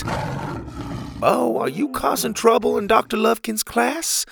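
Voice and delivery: high-pitched questioning father voice; concerned, high-voiced parental query. A narrator's reading. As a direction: ask it as a worried pa. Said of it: "Pa asked, a look of concern".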